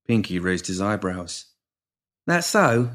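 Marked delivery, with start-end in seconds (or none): 2.3-2.9 amiably. A narrator's reading. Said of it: "he said amiably".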